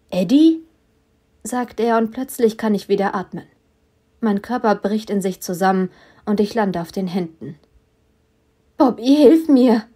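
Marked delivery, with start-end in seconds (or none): 0.1-0.6 surprised call. A narrator's reading. Bobby surprised on porch. 8.8-9.8 croaking. A narrator's reading. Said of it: "krächze ich".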